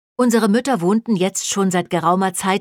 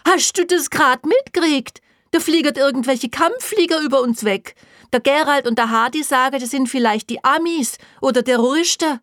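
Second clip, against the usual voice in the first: high, loud, agitated woman; worked-up, raised-voice report; excited calling; 7 semitones higher, 3 dB louder than usual. Acted. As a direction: blurt out alarming news. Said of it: "rief Rose".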